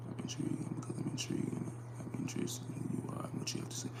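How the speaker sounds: soft unvoiced whisper. Real, not acted. Streamer murmuring to chat, whispered. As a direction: whisper it close to the mic.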